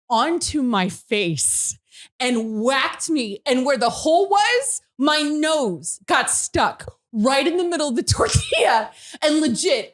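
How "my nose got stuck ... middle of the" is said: ordinary talk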